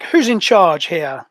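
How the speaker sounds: slow, loud, commanding through a helmet speaker; filtered armour voice. Acted. Armoured stranger demanding the leader, filtered loud voice. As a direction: boom it out like a voice filtered through a suit comms system. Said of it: "his voice is filtered".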